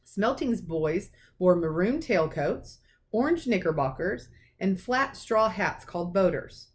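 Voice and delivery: usual speaking voice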